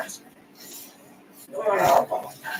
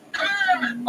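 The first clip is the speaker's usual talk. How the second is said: shouting; 7 semitones higher than usual. Acted. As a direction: yell it through the door. Trevor calling at the door.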